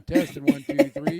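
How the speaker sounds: loud, booming soldier mic check; booming Marine voice. Real, not acted. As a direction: boom it out like a drill sergeant. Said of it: "use your booming Marine voice".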